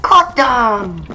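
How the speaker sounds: triumphant shout. Real, not acted. Player celebrating getting them, triumphant shout. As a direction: yell it out on landing the kill. Kill in the game.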